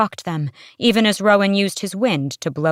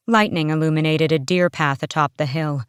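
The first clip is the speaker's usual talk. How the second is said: ordinary delivery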